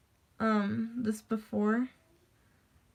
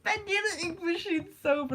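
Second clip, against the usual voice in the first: much higher put-on voice; silly imitation; 9 semitones higher than usual. Imitating a friend's silly reply, high put-on voice. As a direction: squeal out nonsense, playfully imitating.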